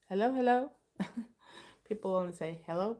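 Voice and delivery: normal talking voice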